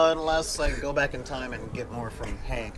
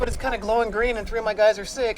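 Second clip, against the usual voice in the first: high, lively mock phone call; acted phone call; 7 semitones higher, 5 dB louder than usual. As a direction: play both sides of the call, higher and animated. Acting out the phone call scene.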